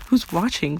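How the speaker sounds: ordinary delivery, nothing marked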